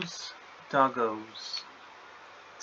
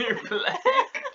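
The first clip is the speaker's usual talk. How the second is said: high laughing tease; 3 dB louder than usual. Real, not acted. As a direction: laugh through the words. Laughing tease between friends.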